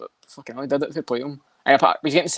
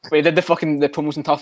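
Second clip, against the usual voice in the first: normal talking voice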